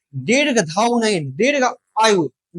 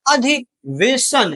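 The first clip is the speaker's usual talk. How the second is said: अधिवेशन drawn out very slowly, a little higher; slowly spelled out; 4 semitones higher, much slower than usual. Shown as a demonstration. Teacher dictating अधिवेशन to students, slow and drawn out. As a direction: stretch each syllable of अधिवेशन.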